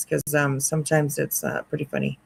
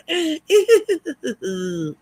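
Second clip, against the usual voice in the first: high giddy giggle; real giggling fit; 12 semitones higher than usual. Real, not acted. Laughing after giddy imitation.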